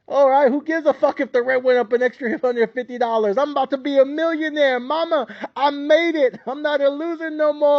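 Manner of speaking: high, hyped-up mock bragging; mock gloating. Podcaster mocking newly rich people, high hammed-up gloating. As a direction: crow like a newly rich fool.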